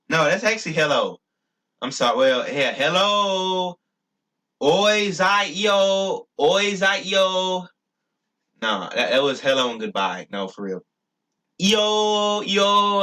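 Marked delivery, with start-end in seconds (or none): 2.7-3.8 high, long-held hello; drawn-out greeting. Pretend. Speaker shows what his word means, high drawn-out greeting. 4.6-7.7 high, very slow sing-song greeting; drawn-out calling. Pretend. Speaker calls hello and goodbye in his made-up language, high and stretched. 11.6-13.0 high, long-held "yo"; drawn-out calling. Pretend. Speaker demonstrates his word for goodbye, high and drawn out.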